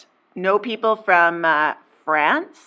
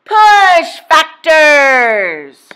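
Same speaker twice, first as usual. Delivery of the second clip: very slow, loud and higher proclamation; grand reveal; 7 semitones higher, 12 dB louder, much slower than usual. Acted. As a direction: land it like a prize announcement. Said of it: "drumroll please".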